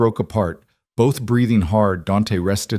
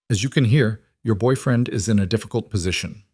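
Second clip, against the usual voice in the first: ordinary delivery, nothing marked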